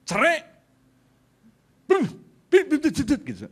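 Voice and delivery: high, loud mock blasts of bum and bim; explosion noises. Preacher acts out a marriage blowing up, loud mock explosions. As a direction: burst out with cartoon bomb sounds.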